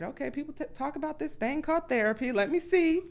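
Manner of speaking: high, wary, trying it out; reluctantly giving therapy a go; skeptical curiosity. Retold or imitated. Deciding to try therapy.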